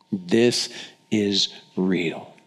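usual speaking voice

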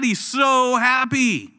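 normal talking voice

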